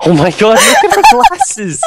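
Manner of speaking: high squeal through laughter; laughing. Real, not acted. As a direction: shriek with laughter. Laughing at glasses.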